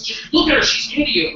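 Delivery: high, loud urging voice in his head; insistent inner voice. Acted. Inner voice arguing with comic about the girl, insistent urging. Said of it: "and then the voice goes".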